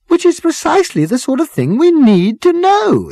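put-on high, loud, insistent girl; insistent strident girl. A narrator's reading. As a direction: press the point stridently. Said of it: "insisted the girl".

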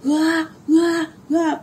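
repeated half-voiced scream imitation; imitated scream. Imitating the apparition's screams, mild mock scream. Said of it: "yang scream gitu kan".

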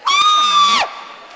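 long, piercing high shriek; mock terrified scream. Preacher acting the scared victim, hammed-up scream. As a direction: scream at full volume as if ambushed.

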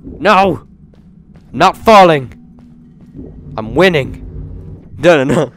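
loud, high cries of alarm; panicked yelling. Real, not acted. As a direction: yell as you almost fall. Nearly falling in game.

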